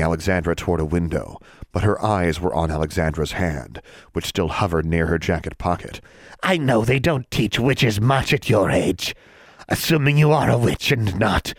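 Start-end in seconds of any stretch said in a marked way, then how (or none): 6.4-11.5 a cunning hag mocking; taunting hag.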